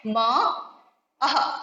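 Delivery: ordinary delivery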